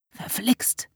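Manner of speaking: sharp whispered curse; hissed curse. A narrator's reading. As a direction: spit out a hushed curse. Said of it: "fluchte Florian plötzlich".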